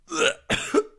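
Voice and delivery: high, loud gag and cough; retching at the smell. Acted. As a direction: choke back the urge to vomit. Gagging at the stench.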